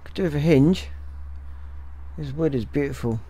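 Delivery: ordinary talk, nothing marked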